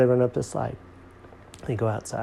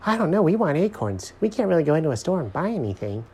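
high, chattering squirrel voice; objecting squirrels; 7 semitones higher, faster than usual. A narrator's reading.

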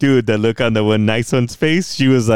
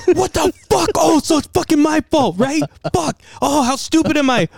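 very high, louder sarcastic outburst; hammed-up outraged phone argument; indignant mock tirade; 4 dB louder than usual. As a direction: rant in a squealing register, then cut off the call. Acted quarrel on phone, hangs up.

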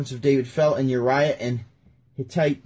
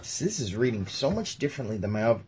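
ordinary talk, nothing marked

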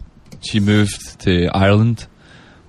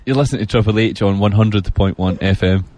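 broad Scottish station ident; full Scottish accent; 3 semitones lower than usual. Shown as a demonstration. Guest asked by radio host for a sentence, full Scottish accent. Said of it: "a whole sentence in your full Scottish accent".

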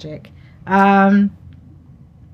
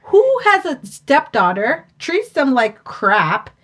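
higher-pitched retold disbelief; mock indignation; 8 semitones higher, 4 dB louder than usual. Viewer reacting to how a stepdaughter is treated, mock indignant. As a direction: argue the point as your baffled past self.

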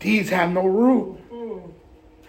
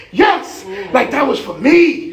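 excited. Acted. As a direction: act out an eager shout of faith. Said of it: "we gotta be excited".

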